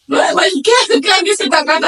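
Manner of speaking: high, loud, tearful disbelief; can't believe it, voice cracking; stunned disbelief. Retold or imitated. Nurse hears the news. (incredulidade aguda e chorosa)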